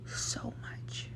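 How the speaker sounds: barely audible whisper on so much. Retold or imitated. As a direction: let it fade into a whisper. Quoted retort to nosy strangers.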